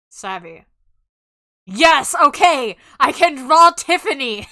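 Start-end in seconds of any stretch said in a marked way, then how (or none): 1.7-4.5 loud relieved cheer; excited cheering. Real, not acted. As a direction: shout with relief. Celebrating drawing Tiffany.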